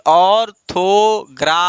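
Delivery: "Orthography" as ऑर, थो, ग्रा, फी, pausing between; syllable by syllable. Shown as a demonstration. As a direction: dictate "orthography" to a class in four beats. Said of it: "बोलिए". ("Orthography" को खंड-खंड करके बुलवाएँ)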